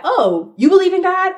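high, loud mock surprise on believe; surprised question. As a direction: pop up in surprise, playful. Mock casual quote of partner.

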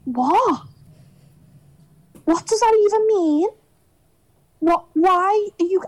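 high-pitched, incredulous questioning; baffled disbelief, halting between questions; retold bafflement. Retold stunned reaction to insult.

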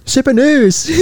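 high-pitched, strained mock Chinese shout; caricatured Chinese accent, voice way up. Host playing triads coming after them, mock Chinese. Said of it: "What if the triads come after us?".

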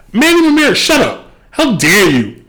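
high-pitched, indignant scolding; indignant rebuke. Pretend. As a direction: tell him off in high outrage. Mock-outraged rebuke of Manny Ramirez.

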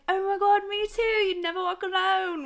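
high, loud mock-British gushing; put-on British accent. Friend retells bluffing a Liverpool fan, excited put-on British accent. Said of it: "we didn't have those accents at all".

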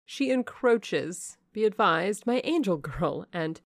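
a mother gently warning her proud daughter; Lady Ingram. Acted. Lady Ingram warns Blanche, slow and high.

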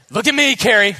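put-on loud, high Russian-accented coach shouting; Russian coach yelling to his gymnast. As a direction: call out hard in a Russian accent. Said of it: "He's got, like, a Russian accent".

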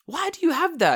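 high, loud, incredulous question; hammed-up disbelief, voice leaping up; retold bafflement. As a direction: demand an explanation in mock amazement. Retells his baffled question.

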